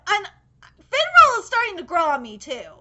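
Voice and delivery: ordinary delivery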